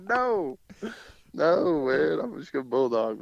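high-pitched balking no; retold refusal.

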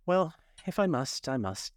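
resigned, mildly melancholy; melancholy air. A narrator's reading. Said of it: "with a melancholy air".

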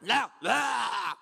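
loud mock scream; exaggerated yelled outburst; hostile rejection. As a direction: shriek it to drive them away. Re-enacts the hostile stranger.

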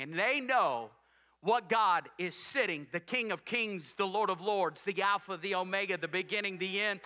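normal talking voice

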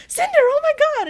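high, loud relieved cry; relieved excited shout. Retold or imitated. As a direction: shout her name in relief. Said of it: "he's like".

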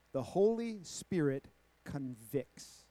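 ordinary delivery

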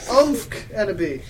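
slow, high, loud wail; hammed-up tearful confession; sobbing. Professor Sinister confessing addiction in tears, mock sobbing. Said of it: "sobbing limpid tears".

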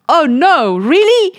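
high, loud startled cry; jolted by the news; high loud gasp of disbelief; shocked. Retold or imitated. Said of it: "I was so shocked".

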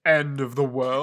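slow, put-on ominous phone voice; mock Akio. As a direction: drag it out like a mysterious villain. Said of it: "Akio voice goes".

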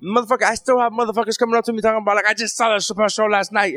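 high-pitched retold rant; Donnell Rollins. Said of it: "he, he goes".

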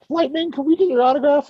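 high, quick fangirl squeal; squeaky gushing groupie begging a star; giggling girl groupies. Acted. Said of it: "they're, like, two giggling girl cars".

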